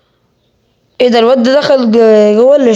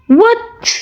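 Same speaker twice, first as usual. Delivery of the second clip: sudden high startled what; a high squeak of real surprise; stunned what; 11 semitones higher, much slower than usual. Real, not acted. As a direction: jump up in pitch, caught off guard. Stunned by what happens in game.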